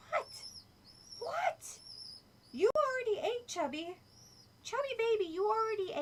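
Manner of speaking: teasing the chubby pet in a high voice. Real, not acted. Owner scolding her chubby pet in high baby talk.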